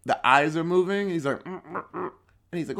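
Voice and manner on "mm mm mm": quiet, closed-mouth straining grunts; muffled grunts. Acted. Waxed man can only move his eyes, muffled grunts. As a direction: imitate someone grunting through sealed lips. Imitates sealed man trying to speak.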